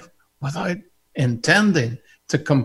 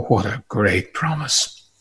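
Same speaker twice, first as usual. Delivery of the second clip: usual speaking voice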